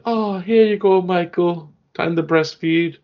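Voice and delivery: high creepy cooing mom; zombie mother voice. Acted. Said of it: "Zombie Michael's like".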